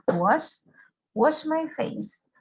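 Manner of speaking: "Wash" said alone, then "wash my face" slow with a clear sh; teacher modeling pronunciation. Shown as a demonstration. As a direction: repeat "Wash, wash my face" slowly for students. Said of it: "¿Escucharon la pronunciación?".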